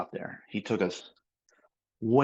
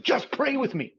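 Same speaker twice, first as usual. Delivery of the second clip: pitched way up, pleading and a little comic; high mock plea. Retelling how he begged the man to pray, high-pitched mock plea. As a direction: squeak it out like a desperate plea. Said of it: "And I was like".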